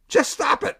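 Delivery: a playful nod to the TV shrink; Bob Newhart impression. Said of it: "let's do the Bob Newhart".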